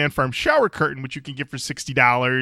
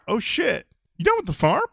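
impressed guest; 8 semitones higher than usual. Retold or imitated. Voicing impressed car guest.

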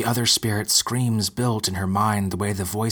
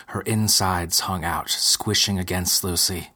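ordinary delivery, nothing marked

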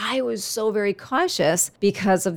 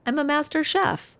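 higher, lightly voiced proud little boy; imitating the son; 7 semitones higher than usual. Son proudly telling mom he cooked, higher childlike boast. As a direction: pitch up and boast like the kid. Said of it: "It's not exactly how he said it".

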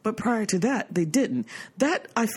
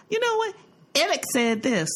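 mother quoted; 8 semitones higher than usual. Retold or imitated. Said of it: "And then she go".

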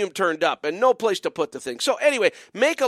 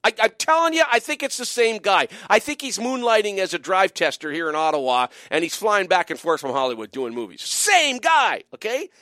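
ordinary delivery

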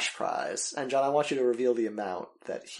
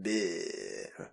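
zombie noise; 5 semitones lower, 9 dB quieter than usual. Shown as a demonstration. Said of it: "what did it sound like?".

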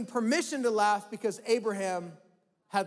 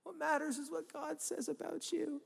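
preachy voice; 7 semitones higher than usual. Retold or imitated. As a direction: ham up the pious preacher line. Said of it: "the real preachy thing to say".

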